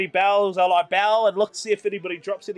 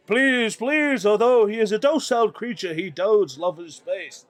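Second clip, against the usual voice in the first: loud, grand showman's plea to the crowd; pompous circus barker warning onlookers; showman announcing; 4 dB louder, slower than usual. Acted. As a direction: address the crowd like a ringmaster. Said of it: "I'm like".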